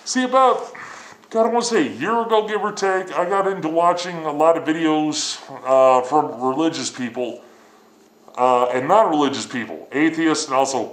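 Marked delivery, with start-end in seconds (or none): none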